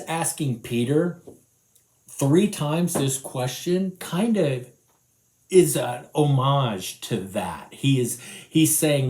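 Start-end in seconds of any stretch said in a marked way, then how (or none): none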